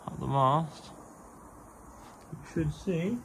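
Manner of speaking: ordinary delivery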